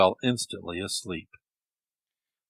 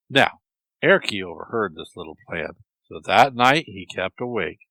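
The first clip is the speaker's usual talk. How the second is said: ordinary delivery, nothing marked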